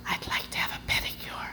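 sheepish whisper. Retold or imitated. Nervous newcomer asking the nail salon woman, whispering. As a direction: whisper it, embarrassed to ask. Slinking into the pedicure place.

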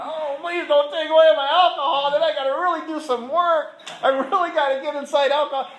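high, loud mock whine; whiny pleading self. Mocking his old self begging to keep alcohol, high whine. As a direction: beg in a hammed-up childish voice. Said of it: "like, holding on".

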